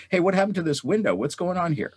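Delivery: high-pitched, hurried call down to someone below; retold yell. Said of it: "I'm yelling at the contractor below".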